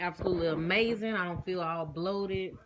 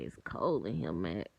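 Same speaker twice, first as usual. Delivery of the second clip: family catchphrase voice; 12 semitones lower, 5 dB quieter than usual. Retold or imitated. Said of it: "When it's cold in my family, we say".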